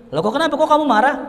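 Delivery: high and loud, caught off guard; why are you angry, rising in surprise; puzzled father. Acted. Father surprised by his angry son, high and puzzled.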